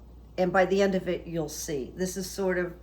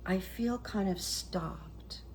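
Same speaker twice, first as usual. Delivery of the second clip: whisper; 8 dB quieter, much slower than usual. Real, not acted. Hushed psychic admission.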